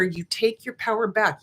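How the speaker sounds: ordinary talk, nothing marked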